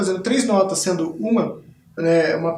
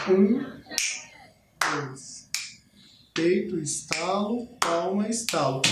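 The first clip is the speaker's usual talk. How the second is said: peito, estalo, palma, estalo spoken slowly and softer; slow counted beats; 6 dB quieter, slower than usual. Shown as a demonstration. Teacher counting the body rhythm, slow and softer. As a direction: call each beat in time. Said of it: "o mesmo ritmo base".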